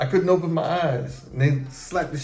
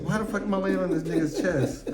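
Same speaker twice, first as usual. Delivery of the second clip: high, confused question broken up by laughter; laughing disbelief; 6 semitones higher than usual. Retold or imitated. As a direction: laugh through the memory of waking up confused. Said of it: "I'm like".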